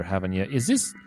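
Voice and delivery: ordinary talk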